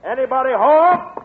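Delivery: long, loud high call; slow drawn-out shout into the rooms. Acted. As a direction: stretch the call so it carries through the house. Calling into an empty house.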